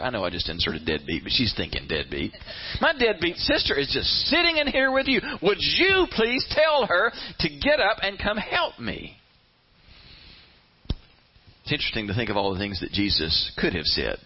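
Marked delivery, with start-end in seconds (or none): 2.8-9.2 high, indignant complaint; playing the victim. Martha demanding that Jesus send her sister, aggrieved victim. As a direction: plead in a high, put-upon voice. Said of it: "she is a victim".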